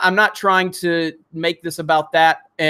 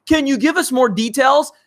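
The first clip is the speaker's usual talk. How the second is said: high, sneering mimic of a support rep; mocking Team YouTube; 8 semitones higher than usual. Mocking Team YouTube's reply to viewers with a high, snide voice. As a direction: parrot their question back in a snide higher voice. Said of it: "And what do they do?".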